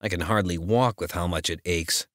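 ordinary delivery

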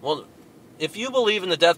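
ordinary delivery

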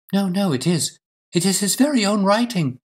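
high, emphatic wife's protest; earnest, high-pitched contradiction; insistent protest. Acted. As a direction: insist against all doubt, pitch well up. Wife insists against detective's doubts.

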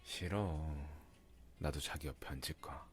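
normal talking voice

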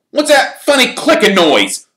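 loud, high shouted question; high-pitched call to a teammate. A narrator's reading. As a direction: shout it while hurtling past. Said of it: "called Fred as they hurtled".

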